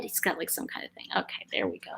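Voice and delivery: soft whispered aside; whispering. Real, not acted. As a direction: hush it under your breath. Quiet aside about the dog.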